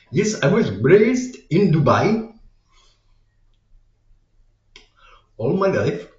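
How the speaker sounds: a hammed-up Punjabi accent, gushing about New York. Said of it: "a Punjabi accent".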